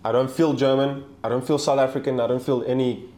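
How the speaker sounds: ordinary talk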